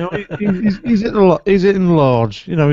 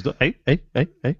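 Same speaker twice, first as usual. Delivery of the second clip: put-on Scouse accent; faster than usual. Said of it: "Sounds like Scousers".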